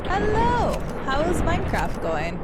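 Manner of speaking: light up at a friend arriving; excited hello. Real, not acted. Greeting a chatter back from Minecraft, loud excited hello.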